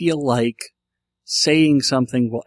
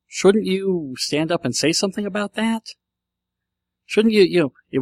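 higher-pitched, lightly acted inner voice; a touch of a nagging conscience, pitched up; 7 semitones higher than usual. Part of him questioning his silence, lightly voiced higher conscience. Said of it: "so part of me says".

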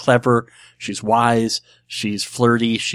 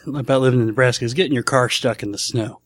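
usual speaking voice

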